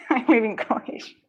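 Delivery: half-laughing, half-wailing self-pity; laughing mock lament. Real, not acted. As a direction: break into a laugh mid-complaint. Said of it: "I look at decks and I cry".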